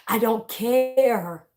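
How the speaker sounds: slow, drawn-out CARE after a laugh; gleefully dismissive. Real, not acted. Speaker laughing off critics of how they talk, gleefully dismissive. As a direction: stretch "care" right out, amused.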